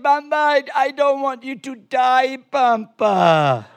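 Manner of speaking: put-on high, tearful little-girl wail; mock-bawl, sliding down on the last word; crying child. Granddaughter afraid her grandpa will die, mock crying. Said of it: "starts crying hysterical".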